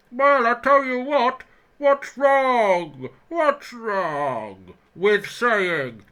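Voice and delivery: booming, high, slow Bane-style villain voice; Harry Bain voice. Acted. Mocking Kane's team talks as a Batman villain. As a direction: roar it like a supervillain. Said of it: "I'm just gonna be Harry Bain".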